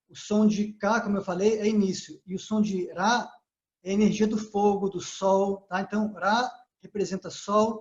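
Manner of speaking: ordinary delivery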